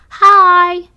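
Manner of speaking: very loud, high holler; loud yell. A narrator's reading. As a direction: yell a greeting at full volume. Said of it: "I yell to Mister Hippo with the loudest".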